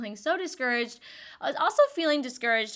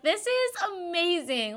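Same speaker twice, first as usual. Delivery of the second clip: delighted gush; 8 semitones higher than usual. Retold or imitated. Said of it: "I'm like".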